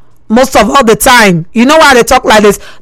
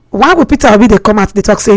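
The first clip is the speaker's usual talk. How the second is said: usual speaking voice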